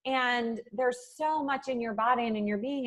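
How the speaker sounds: ordinary delivery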